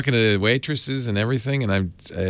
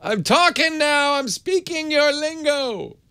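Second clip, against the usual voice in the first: mock yelling at a foreigner; slower than usual. Mocking people who shout at voice assistants, slow mock yell. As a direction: bellow it slowly as if the listener can't understand. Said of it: "you just yell to make them hear you".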